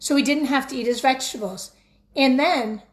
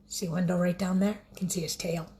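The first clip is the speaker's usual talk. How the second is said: hushed pointing-out whisper; 5 semitones lower, 6 dB quieter, faster than usual. Real, not acted. Reader showing children the picture, whispered. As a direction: whisper like sharing a secret. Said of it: "whispered aside to kids".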